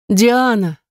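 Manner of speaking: high excited call of a name; eager blurt. Acted.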